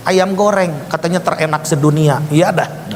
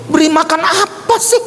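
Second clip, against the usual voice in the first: much higher, irritated questioning; exasperated echo; 10 semitones higher than usual. Retold or imitated. Preacher replaying his baffled reply to the message, exasperated. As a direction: throw the words back, high and impatient.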